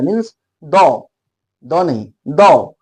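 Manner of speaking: slow, drawn-out "द" with a nasal chandrabindu; nasal "the" demo. Shown as a demonstration. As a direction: stretch द slowly to show the moon mark. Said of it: "द के ऊपर चांद है". (धीरे से चंद्रबिंदु वाला "द")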